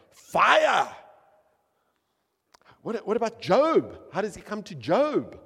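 high, loud, driving preacher's cry; full-force preaching. Acted. Preacher rousing the church about fire and wind, loud and high. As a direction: hammer each question home to the congregation. Preaching God's fiery appearances.

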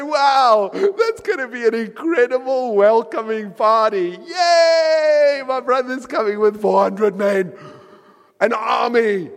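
sarcastic shouted yay; mock cheerful Jacob. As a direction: shout it like a fake party welcome. Mocking Jacob's fake cheer.

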